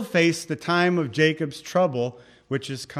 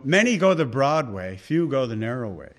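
ordinary talk

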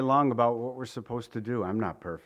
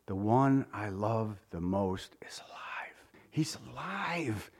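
awed whisper; 4 semitones lower, 7 dB quieter than usual. Acted. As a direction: whisper it as if barely believing. Mary realizes Jesus is alive.

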